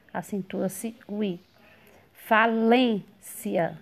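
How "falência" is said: "Falência" slow and split, loud long LÊN; stressed middle syllable. Shown as a demonstration. Teacher showing the tonic syllable, "Falência" with stretched, louder LÊN. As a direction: punch LÊN in "Falência", pause before the ending. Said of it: "sílaba tônica lem".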